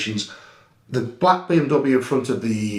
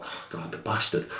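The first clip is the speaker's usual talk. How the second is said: muttered thought under the breath, much softer; whispered curse; 7 semitones lower, 10 dB quieter than usual. Retold or imitated. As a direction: curse it to yourself, barely voiced. Inner thought while spotting filming.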